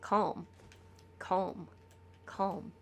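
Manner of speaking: soft, fading repeated calm; soothing mantra. Shown as a demonstration. Streamer showing chat his calming mantra, fading soft. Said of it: "All I did was calm".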